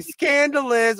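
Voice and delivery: high, drawn-out "Scandalous" like the Ashleys; Ashley-style "Scandalous". Shown as a demonstration. Podcast host shows how to say the catchphrase, in a high drawn-out voice. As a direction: sing out "Scandalous" in their voice. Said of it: "You gotta say".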